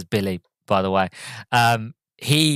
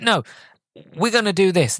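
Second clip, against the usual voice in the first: imitating a flustered leader, pitched up; embarrassed insistence; 8 semitones higher than usual. Richard losing his argument with bus driver, embarrassed insistence. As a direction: insist in a high voice, losing ground. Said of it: "Richard's realising he's losing his ground".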